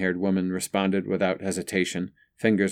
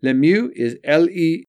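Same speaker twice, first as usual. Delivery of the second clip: high, lilting, louder spelling; sing-song; 9 semitones higher, 6 dB louder than usual. A narrator's reading. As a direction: sing out the letters as you spell. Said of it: "sing-song".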